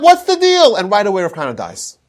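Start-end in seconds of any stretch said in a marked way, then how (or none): 0.0-0.7 loud, high indignant shout; furious old sage yelling; angry outburst. Acted. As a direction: bellow it at the smirking student. Angry old sage confronts smirking student.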